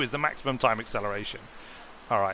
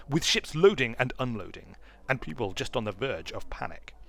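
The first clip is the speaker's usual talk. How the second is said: usual speaking voice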